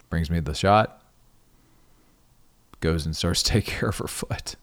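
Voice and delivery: usual speaking voice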